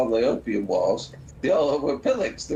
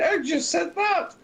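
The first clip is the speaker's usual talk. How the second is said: retold protest. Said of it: "he keeps saying".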